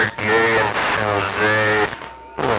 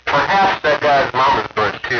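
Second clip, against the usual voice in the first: radio call-out; 6 semitones higher than usual. Real, not acted. Calling out on the radio.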